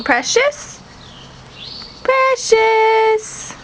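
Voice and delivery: high, sing-song call to the cat; calling a pet. Real, not acted.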